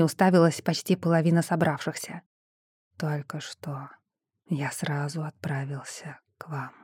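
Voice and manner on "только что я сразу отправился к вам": soft unvoiced whisper; hushed mind voice. A narrator's reading. Shadow speaks telepathically.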